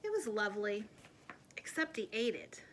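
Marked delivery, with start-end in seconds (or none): none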